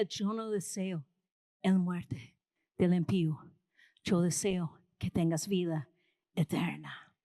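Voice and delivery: the preacher voices God telling Israel he wants life, in a low solemn voice; voice of the Lord. Acted. The preacher voices God pleading with his people, deep and solemn. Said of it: "Pero el Señor decía".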